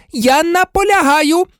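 very high, frantic demand; outraged nurse at the top of her range; hysterical insistence. A narrator's reading. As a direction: insist in a high-pitched outburst. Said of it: "істерично вигукнула мадам Помфрі".

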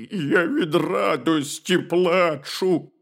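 slow, drawn-out bear whine; tearful bear cub. A narrator's reading. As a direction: stretch it out as a weepy bear cub. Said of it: "ведмедик заплакав".

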